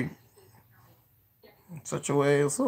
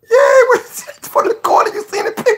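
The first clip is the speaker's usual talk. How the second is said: laughing; 4 dB louder than usual. Real, not acted. Laughing at a clip with friend.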